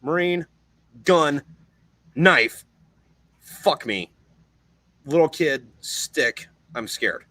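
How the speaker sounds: halting single words with long pauses; stunned deadpan. Retold or imitated. Marine comparing himself to a kid with a stick, stunned. Retells stunned list of the contrast.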